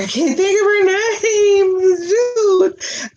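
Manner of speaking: high, crying-out frustration over a forgotten name; exasperated wail. Real, not acted. Can't recall the singer's name.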